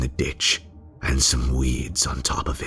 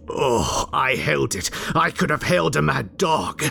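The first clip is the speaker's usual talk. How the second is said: climbing much higher, possessed; rising frenzy; 11 semitones higher than usual. Acted. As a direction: let the thrill run away with you. Frenzied memory of holding the bird.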